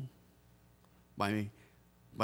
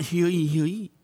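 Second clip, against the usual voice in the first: lightly voiced town crier's call; raised, higher echo of a crier's cry; a hint of a proclaiming town crier; 5 semitones higher, 4 dB louder than usual. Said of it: "what they call a town crier".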